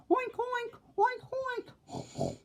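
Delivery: high squealing oinks; oinking pigs. A narrator's reading. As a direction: oink like a pig. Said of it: "bellowed at the top of their lungs".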